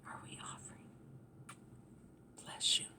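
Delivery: soft unvoiced whisper, fading away. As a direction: hush it like a closing prayer. Whispered blessing.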